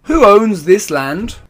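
slow, loud, high royal call. Acted. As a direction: boom the question from the carriage. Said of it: "the king called out".

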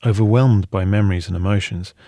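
ordinary delivery, nothing marked

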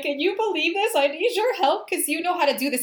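high, exasperated plea to a friend; complaining dramatically to Christy; mock upset whine. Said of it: "I'm so upset".

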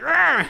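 loud high stabbing roar; dinosaur voice. Retold or imitated. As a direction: roar it out like a beast attacking. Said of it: "he's like".

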